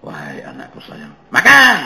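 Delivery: sweet call then a barked order; tender call snapping into a loud harsh command. Pretend. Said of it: "perintah yang disampaikan dengan kasar".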